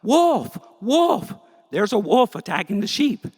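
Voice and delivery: very high, loud panicked scream; shepherd boy screaming at a real wolf, terrified. Acted. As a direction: scream for help in real terror. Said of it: "he screams out".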